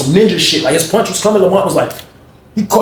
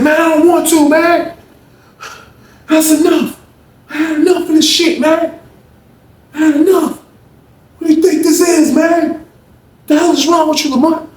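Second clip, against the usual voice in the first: high-pitched, fed-up outburst; acted angry protest; 12 semitones higher than usual. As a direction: act out an indignant protest, voice up high. [name] protests in acted quarrel.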